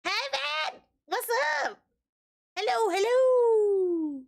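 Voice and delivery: high, drawn-out hello; excited greeting. Real, not acted. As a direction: call out a big happy hello.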